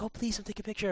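high, soft polite plea; polite clerk plea. Retold or imitated. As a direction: gently beg with a bow. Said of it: "was like".

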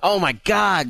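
high, loud burst of excitement; excited. Real, not acted. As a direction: blurt it out thrilled. Excited game news announcement.

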